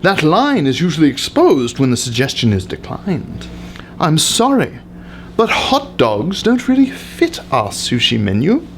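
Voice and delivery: usual speaking voice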